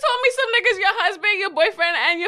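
high-pitched, loud, laughing disbelief; real high, heated teasing protest; incredulous call-out. Real, not acted. Calling out friend's denial.

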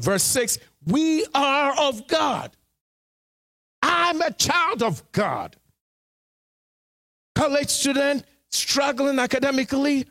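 high, urgent pulpit delivery, pushing each point; rising to shouts on the struggles; full-force preaching. Acted. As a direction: preach it hard to the congregation.

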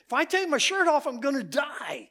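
put-on high, loud, frightened little-boy shout; boy insisting he will die, high and loud; scared child's cry. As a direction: cry it out like a terrified kid. Boy explains his fear.

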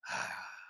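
soft, contented exhale; relieved sigh. Acted. Friend showing how relaxed it feels, soft sigh.

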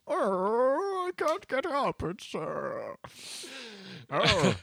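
put-on high, creaky frail old man groan. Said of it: "his back is hurting him".

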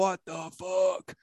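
slow, drawn-out stunned what-the; mock disbelief, dragging each word out; a touch of hushed amazement, slowed right down. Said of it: "And I'm like".

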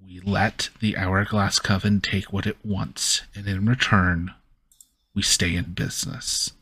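ordinary talk

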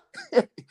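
sudden high delighted laugh; high burst of laughter. Real, not acted. Hearing the opponent conceded, a high delighted burst of laughter. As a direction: let a surprised laugh burst out.

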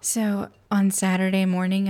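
normal talking voice

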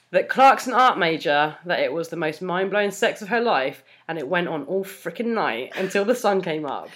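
measured fan fiction reading; story narration. A narrator's reading. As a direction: read it out like an audiobook narrator. Reads fan fiction aloud.

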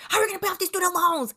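high, fast busybody question; mock commenters. Online commenters questioning her quitting, mocking high imitation. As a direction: imitate nosy commenters, rushed and high-pitched. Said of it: "people literally in the comments like".

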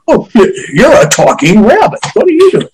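mock-incredulous high voice, pitch leaping up at the end; mocking Bill Murray. Director mocking Bill Murray asking the invisible rabbit what it's doing, high and incredulous. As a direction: send up a baffled actor talking to thin air. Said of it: "He was constantly going".

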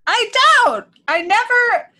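high defensive outburst. Real, not acted. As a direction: defend yourself in a high squeal.

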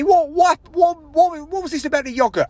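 sputtered, repeated questioning; mocking imitation. Mimics manager querying the yogurt.

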